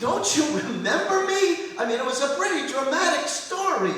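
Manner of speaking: high-pitched, exasperated shout; incredulous shouting. Acted. Pupil begging his old rabbi to remember, incredulous shouting. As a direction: plead loudly in disbelief, voice shooting up.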